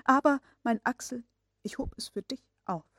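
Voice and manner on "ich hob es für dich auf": whispered. Acted. Uncle confides to nephew.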